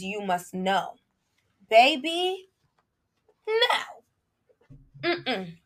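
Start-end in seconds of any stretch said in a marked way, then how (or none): 1.7-5.6 sassy dismissal. Real, not acted. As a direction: wave it off with sass. Dismissing religious rules.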